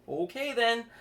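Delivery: high, loud nervous okay; flustered high voice. Acted. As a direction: squeak it out, flustered. Chris gives in, flustered.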